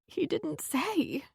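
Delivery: soft, slow, high tearful whisper-like reply; small tearful reply. Acted. Answering her friend after sniffling, soft tearful voice.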